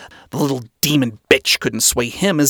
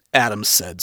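ordinary delivery, nothing marked

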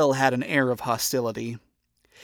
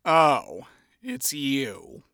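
flat, annoyed, fading at the end; unimpressed; much slower than usual. A narrator's reading. Lucifer greeting his rival Alastor, annoyed. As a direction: sound thoroughly unimpressed. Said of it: "Lucifer's unimpressed and annoyed response".

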